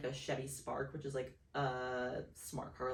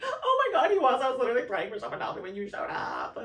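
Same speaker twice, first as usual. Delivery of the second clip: loud, high, rushed wail; hammed-up crying outburst; tearful amazement; 11 semitones higher, 10 dB louder than usual. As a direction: wail it out as if overcome with relief. Retold overwhelmed tearful reply.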